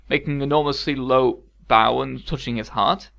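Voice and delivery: ordinary delivery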